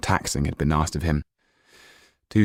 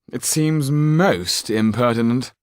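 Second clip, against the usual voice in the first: higher, louder, slow haughty question; the Marquis interrupting; 6 semitones higher, 4 dB louder, slower than usual. Acted. The Marquis interrupting the magician, haughty, higher and louder. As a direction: cut the showman off with lofty disdain.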